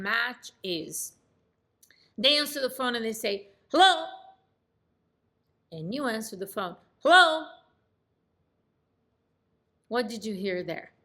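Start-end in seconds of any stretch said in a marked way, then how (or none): 3.7-4.1 loud, high phone pickup; abrupt, loud greeting; loud, brisk hello. Acted. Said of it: "same volume that I heard them speak". 7.0-7.5 loud, high, brisk phone greeting; matched loud hello. Shown as a demonstration. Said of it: "I speak in the same volume".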